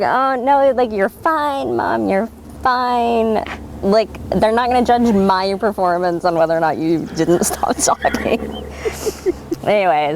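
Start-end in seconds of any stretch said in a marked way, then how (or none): 0.0-8.6 reassuring daughter. Retold or imitated. Reassuring mom.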